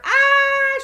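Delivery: loud high scream; shriek. Acted. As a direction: shriek in terror. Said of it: "shrieked Flap".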